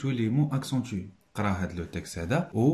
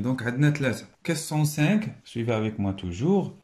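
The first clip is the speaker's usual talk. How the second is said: ordinary delivery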